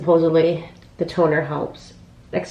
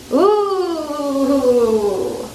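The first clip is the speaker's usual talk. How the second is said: delighted; 7 semitones higher, much slower than usual. Real, not acted. Admiring the bath bomb.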